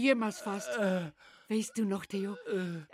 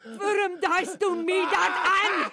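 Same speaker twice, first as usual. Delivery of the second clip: breaking down; 5 semitones higher than usual. Acted. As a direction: lose it, laughing and shouting at once. Frau bricht verzweifelt aus. (verzweifelter, hoher Ausbruch zwischen Lachen und Schreien)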